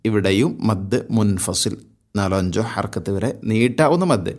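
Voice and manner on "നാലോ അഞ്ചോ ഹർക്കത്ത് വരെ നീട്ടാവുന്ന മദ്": usual speaking voice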